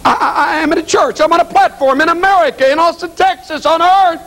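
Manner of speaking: high, agitated stammer into declaration; rattled insistence. Acted. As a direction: insist on it as if panicking. Preacher insisting it is not real.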